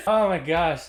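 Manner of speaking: ordinary delivery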